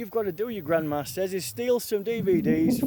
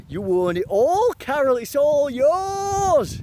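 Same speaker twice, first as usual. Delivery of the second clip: slow, higher, far-off proclaiming voice; grand handover; 4 semitones higher, 3 dB louder, slower than usual. Acted. Speaker handing everything to Carol, slow grand proclamation. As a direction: announce it slowly like a ceremony.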